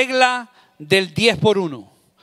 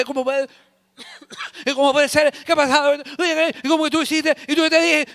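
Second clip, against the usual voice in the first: excited person; 7 semitones higher, faster than usual. Retold or imitated. Imitating agitated person.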